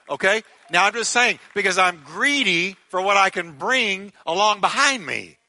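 loud, high fervent preaching; preaching at full force. Acted. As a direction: preach it out to the back row.

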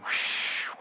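long airy whoosh; imitated whoosh. Preacher showing the storm being stilled, imitated whoosh. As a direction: blow out a rushing whoosh.

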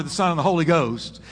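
normal talking voice

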